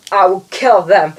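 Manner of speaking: hammed-up villain threat; mock sinister vow. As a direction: declare it like a cartoon baddie. Villain threat, first take.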